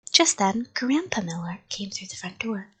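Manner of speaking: ordinary delivery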